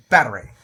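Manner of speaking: loud emphatic "Battery"; great emphasis. Shown as a demonstration. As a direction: hit "Battery" hard and short. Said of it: "saying it like that".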